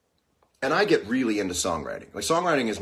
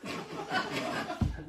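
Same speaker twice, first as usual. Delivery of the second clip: breathy high laugh; 9 dB quieter than usual. Real, not acted. Laugh after Pearl Jam joke.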